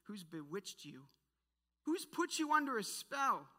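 rising indignant rebuke; with attitude. Acted. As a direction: demand it, building in anger. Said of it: "Says it with some attitude".

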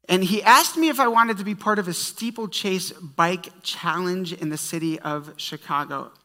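usual speaking voice

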